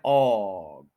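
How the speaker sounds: ऑग drawn out long and falling; long falling AWG for ऑग; stressed syllable. Shown as a demonstration. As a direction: lean hard on ऑग, stretching the open "aw". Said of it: "ओ जी पे stress देना".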